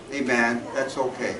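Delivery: ordinary delivery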